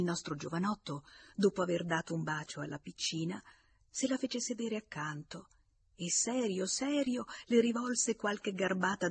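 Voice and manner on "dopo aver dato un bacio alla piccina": ordinary delivery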